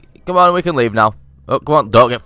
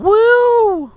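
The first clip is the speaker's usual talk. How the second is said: high, slow, stretched woo; triumphant drawn-out woo; victory whoop; 3 dB louder than usual. Real, not acted. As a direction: celebrate like you've won. Celebrating an easy level.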